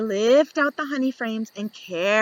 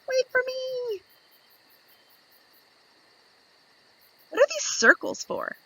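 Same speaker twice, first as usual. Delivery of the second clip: high squeaky bee voice; a tiny curious bee peeking out; little bee voice. Acted. Young bee asks the reader, high and curious.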